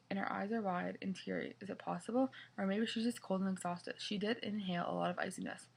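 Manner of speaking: ordinary delivery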